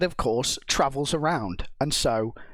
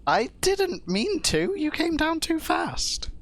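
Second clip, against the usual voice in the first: high defensive whine; slower than usual. Real, not acted. Excusing herself.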